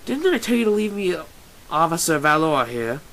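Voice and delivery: lightly voiced loud policeman; hammed-up officer announcement; gruff mock officer. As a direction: play a mock cop, loudly. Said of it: "with your gruffest voice".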